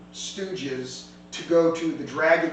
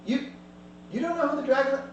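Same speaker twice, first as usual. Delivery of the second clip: ordinary delivery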